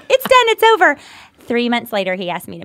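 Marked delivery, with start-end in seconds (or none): 0.1-1.0 high, loud and triumphant; excited declaration. Retold or imitated. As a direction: announce it with glee. Triumphant retelling of her answer.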